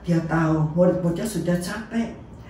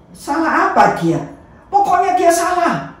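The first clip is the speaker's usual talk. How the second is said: high, loud, insistent; stubborn insistence; 10 semitones higher, 4 dB louder than usual. Acted. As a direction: refuse to give reasons. Crowd insisting.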